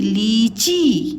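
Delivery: लीची said very slowly, each syllable drawn out; slow stretched word. Shown as a demonstration. Teacher spelling out लीची slowly for pupils. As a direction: draw out लीची slowly, long ee. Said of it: "तो ली ची".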